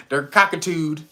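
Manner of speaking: ordinary talk, nothing marked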